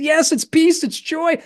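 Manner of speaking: high, wavering voice through happy tears; crying with joy, lightly played; tearful happy crying. To brother, weeping with joy and puzzled. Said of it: "dousing him in my tears".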